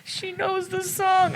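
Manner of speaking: tearful mariachis. Retold or imitated. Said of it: "'cause they were like".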